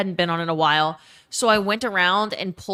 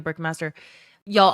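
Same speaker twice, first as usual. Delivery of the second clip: ordinary delivery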